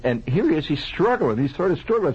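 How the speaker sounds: plain reading voice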